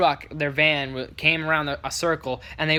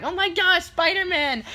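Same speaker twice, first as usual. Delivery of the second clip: put-on high-pitched starstruck kid squeal; imitated excited kids; 14 semitones higher than usual. Little kids meeting Spider-Man, mock excited squeal. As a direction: gush like a thrilled little boy. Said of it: "they're talking their heads off".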